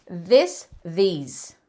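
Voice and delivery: "This" short with S, "these" long EE with Z; short I then long EE; this versus these. Shown as a demonstration. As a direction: contrast "This" and "these". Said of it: "This termina com som de S".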